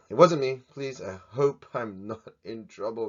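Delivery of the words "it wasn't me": loud playful denial; mock denial. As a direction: blurt it out like a guilty kid. Joking denial after busted.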